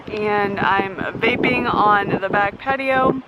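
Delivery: ordinary talk, nothing marked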